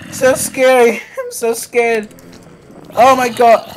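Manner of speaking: high, loud frightened exclamations; real fright; shaken and scared. Real, not acted. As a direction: gasp it out still rattled. Reacting to fright.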